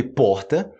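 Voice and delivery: "porta" with a French-like throat R; regional R. Shown as a demonstration. Teacher shows regional Brazilian options, throat-R demonstration. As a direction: say "porta" with the R as a rough H. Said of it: "dependendo da região".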